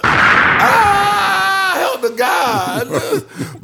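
long, high, loud pretend death wail; hammed-up cry for help, drawn out very slowly; mock scream. Imagining being shot at his door, mock screaming.

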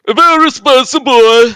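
loud, high hyped-up catchphrase; spicy boy shout. Pretend. Hyping a unit to his co-host, loud and high. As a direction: belt out the catchphrase with glee.

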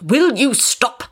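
high, slow angry demand; angry outburst. A narrator's reading. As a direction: snap it at him.

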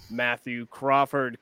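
usual speaking voice

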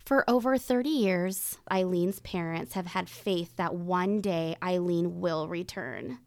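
grave true crime narration; suspenseful crime show narrator. Acted. Reads the case introduction.

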